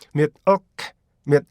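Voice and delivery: 밀크 in separate short beats, myet, uh, k; split-up milk. Shown as a demonstration. Teacher breaks milk into parts for learners, segmented pronunciation. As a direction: chop "밀크" into myet-uhk with tiny gaps. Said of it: "이렇게요".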